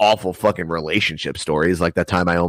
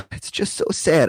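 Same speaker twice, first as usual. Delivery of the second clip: higher, mock whimpering girl voice; mock sad girl; 8 semitones higher than usual. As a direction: lightly fake the weepy girlfriend. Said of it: "she's like".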